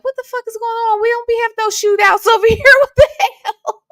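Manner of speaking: high, loud, laughing disbelief; half-laughing, acting out the shock; alarmed disbelief. Retelling firecrackers in the courtyard, laughing alarmed disbelief. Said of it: "I was like".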